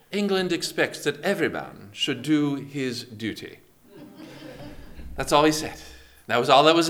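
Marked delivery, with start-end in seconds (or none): 0.1-3.6 put-on British admiral's signal; British accent. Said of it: "oh, I'll do it in British".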